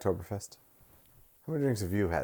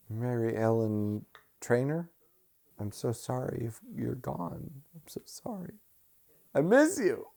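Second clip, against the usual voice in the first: mock grief; 4 semitones higher, 6 dB quieter than usual. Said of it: "a really strong reaction".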